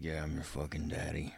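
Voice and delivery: a hushed, lightly voiced tomcat; mock whisper. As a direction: murmur it softly into her ear. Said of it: "starts whispering shit in her ear".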